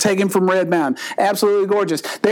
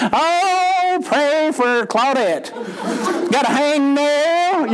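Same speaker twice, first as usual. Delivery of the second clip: high, slow, drawn-out fretting; milk it like a church gossip; church lady; 4 semitones higher, much slower than usual. Retold or imitated. Church member asking prayer for a hangnail, high and slow.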